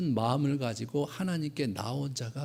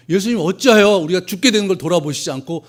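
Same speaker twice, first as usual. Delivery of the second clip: loud, high panicked plea; frantic outcry; 5 semitones higher, 11 dB louder than usual. Retold or imitated. Disciples calling to sleeping Jesus, loud panicked outcry. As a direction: cry out over the storm.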